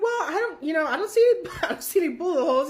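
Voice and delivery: chuckling through the words, fast at the end; laughing. Retold or imitated. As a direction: imitate the old man laughing it off. Gentleman laughing at the claim.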